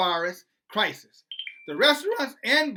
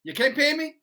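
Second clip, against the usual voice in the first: loud, higher mock mobster threat; gangster voice; 8 semitones higher, 4 dB louder than usual. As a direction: play the Goodfellas loan shark. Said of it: "like the movie Goodfellas".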